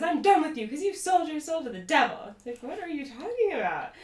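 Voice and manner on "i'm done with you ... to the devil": high-pitched mock outburst; retold fury, pitched up; angry accusation. Castmate cutting off her friend, angry mock outburst. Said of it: "that's when Jacqueline says".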